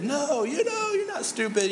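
high-pitched mock consoling; hammed-up insistent comfort; gushing reassurance. Friend contradicting someone's self put-down, gushing. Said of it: "they want you to say what".

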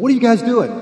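high, loud startled question; alarmed protest. Acted. Carried-off man yelping at his friends in alarm. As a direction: cry out in disbelief.

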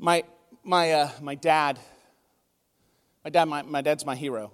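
low, halting, tearful; fighting back tears over his dad; choked up. Real, not acted. Pastor speaking of his hero father through real tears. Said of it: "I'm gonna start crying".

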